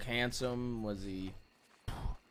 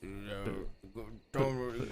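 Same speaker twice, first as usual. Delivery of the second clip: normal talking voice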